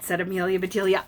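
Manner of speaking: ordinary delivery, nothing marked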